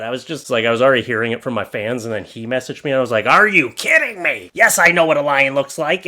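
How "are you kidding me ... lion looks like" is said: loud, incredulous comeback; indignant. Retold or imitated. Replying to a friend who messaged him, indignant. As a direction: reenact an outraged reply, punched out louder.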